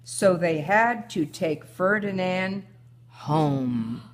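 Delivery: put-on reading a picture book aloud to her child; story reading. A narrator's reading. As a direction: tell the tale gently, like a bedtime story. Mother reads story aloud.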